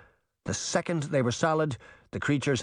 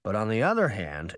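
plain reading voice

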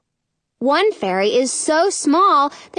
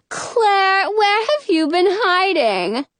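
gushing fairy; 8 semitones higher, slower than usual. Acted. Fairy greeting friends.

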